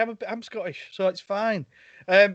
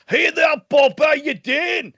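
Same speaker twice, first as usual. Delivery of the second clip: loud, higher put-on Scottish accent; Jamie McCrimmon Scottish voice; 8 semitones higher, 6 dB louder, slower than usual. Host voicing a Scottish guest, loud Scottish accent. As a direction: boom a cheery Scottish hello like a Highlander. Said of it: "alienate all our Scottish viewers".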